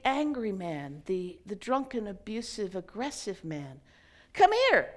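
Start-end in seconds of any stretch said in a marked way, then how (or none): 4.3-4.9 high, loud come here; loud beckoning call. Acted. Elderly man summons the drunk to sit, loud call. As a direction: summon him firmly.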